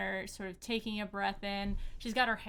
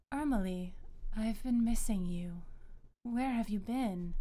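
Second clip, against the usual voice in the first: calming booming goddess. Acted. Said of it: "this booming voice ... still very calming".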